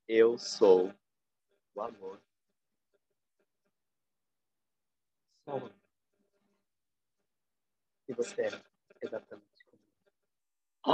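soft loving voice. Retold or imitated. Recalling the inner voice calling itself love, softly hushed. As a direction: let the voice of love speak gently.

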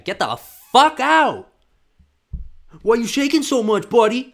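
loud, high sneering official yelling at an athlete; bully the runner off the track, shouting; mock referee throwing an athlete out; mock angry official. Mocking an official disqualifying athlete.

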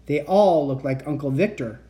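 ordinary delivery, nothing marked

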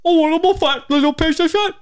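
shrill high mocking squeal; mocking Corden. Imitating the talk host on TikTok, high loud squeal. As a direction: screech it like a hammy comic. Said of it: "James Corden doing, like".

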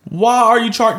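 high, loud disbelieving cry; exasperated outburst. Real, not acted. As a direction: shout it out in disbelief. Ranting at player on video.

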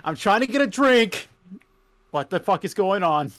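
mock fed-up caller at a bar; irate customer. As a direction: play an exasperated customer ranting down the phone. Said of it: "you're gonna be the customer".

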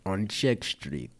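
slow mock Russian accent. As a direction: stretch it out in a bad Russian accent. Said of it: "Your accent is terrible".

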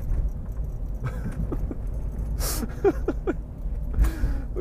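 high, silent wheezing laugh; real wheezing laugh at his own story. Real, not acted. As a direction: crack up breathlessly. Laughing at mom's switch.

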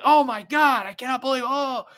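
high, loud cries of disbelief; high-pitched excited outburst, hammed up; re-enacted yelling. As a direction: yell like a fan losing it at the fight. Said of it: "I got yelled at".

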